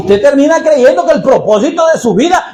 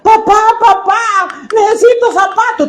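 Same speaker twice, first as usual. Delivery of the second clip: put-on high child's voice, begging at the door; pleading child; 11 semitones higher than usual. Stranger's child begging a father for shoes, in a high childish voice.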